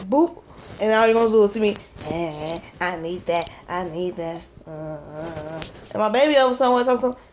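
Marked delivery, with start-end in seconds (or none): 2.1-4.4 low, soft mumble; low, soft, mock-drunk murmur; lost in the dance. Dancing on the news clip. 4.7-5.6 low, very soft uhs; low murmured grunts; low dance grunts. Retold or imitated. Dancing grunts.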